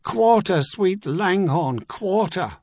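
high, pleading cry for mercy; desperate plea. A narrator's reading. Beaten dragoon begging for quarter, high desperate cry. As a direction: beg for your life. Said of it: "someone bawled".

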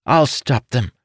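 put-on high, firm childlike declaration; young declaring. Young son declaring to his mother, brave and high.

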